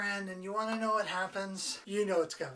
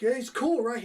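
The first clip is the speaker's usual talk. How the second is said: higher, louder, eager; boy's enthusiasm; 5 semitones higher, 9 dB louder than usual. Retold or imitated. Kid bragging about his rooster to Clint, eager and louder. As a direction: sell the rooster proudly. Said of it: "He's like".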